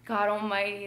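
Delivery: ordinary talk, nothing marked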